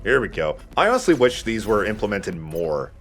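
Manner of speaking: ordinary talk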